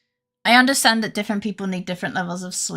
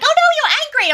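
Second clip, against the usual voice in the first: squeaky high mocking whine; exaggerated high mock of the viewers; mocking chat voice; 5 dB louder, much faster than usual. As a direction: parrot chat back in a silly high voice. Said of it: "you're like".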